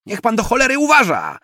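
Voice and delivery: high, loud outburst at the driver; angry shout. A narrator's reading. Woman jolted in a taxi berates the driver, shouting. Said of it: "krzyknęła".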